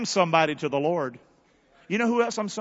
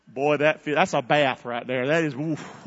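usual speaking voice

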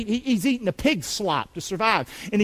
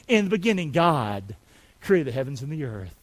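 ordinary talk, nothing marked

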